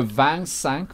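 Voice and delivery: "vingt-cinq" drawn out slowly as vent-sank; French number demo. Shown as a demonstration. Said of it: "which is a crazy word".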